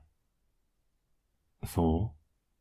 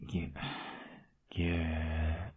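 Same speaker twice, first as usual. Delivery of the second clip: tender; 10 dB quieter than usual. Acted. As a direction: say it while hugging tight. Hugging girlfriend tenderly.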